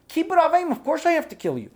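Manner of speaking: high, loud mocking villain voice; hammed-up high Esav. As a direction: play the absurd brother with a sneer.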